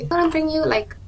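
ordinary talk

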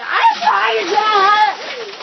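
shouting over the icy shock; excited shout. Real, not acted. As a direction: yell it out as ice water hits. Shouting as the ice water comes.

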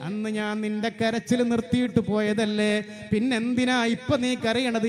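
ordinary talk

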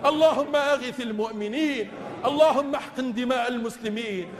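ordinary talk, nothing marked

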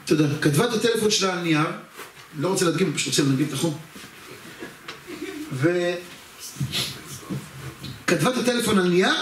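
ordinary talk

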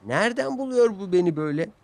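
puzzled puff. A narrator's reading. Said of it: "diye çufladı Thomas".

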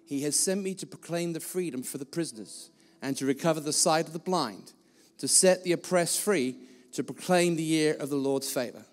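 quiet, measured reading of the Bible passage; scripture reading. Acted. Preacher reading scripture to the congregation, quiet and solemn. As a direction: read the verses softly and solemnly.

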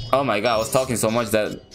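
ordinary talk, nothing marked

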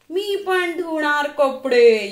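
put-on a kid insisting on joining in; eager shout. A narrator's reading. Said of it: "यश ओरडला".

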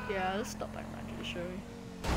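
hushed conspiratorial whisper. Pretend. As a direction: breathe it out, barely voiced. Sneaking toward Rogers in game.

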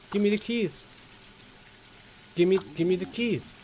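mock character voice. Checking into the motel, high mocking grumbling voice. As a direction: pitch it up and sneer at the clerk. Voicing own character at hotel desk.

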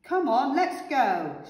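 rally everyone with a shout; eager shout. A narrator's reading. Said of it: "shouted the veggies".

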